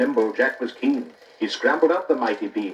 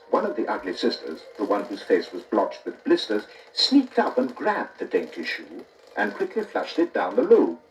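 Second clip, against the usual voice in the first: ordinary delivery, nothing marked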